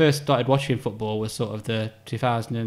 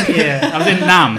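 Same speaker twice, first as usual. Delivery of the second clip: laughing; 5 semitones higher, 8 dB louder than usual. Real, not acted. Joke about being in Nam.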